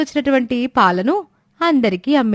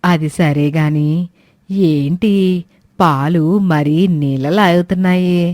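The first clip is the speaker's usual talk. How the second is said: put-on lower-pitched old woman, complaining; old woman Suramma; 6 semitones lower than usual. Old woman scolding the late milk seller, lower grumbling voice. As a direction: grumble like an impatient old granny.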